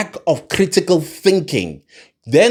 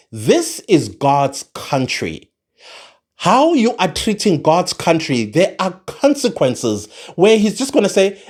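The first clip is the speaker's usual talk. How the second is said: ordinary delivery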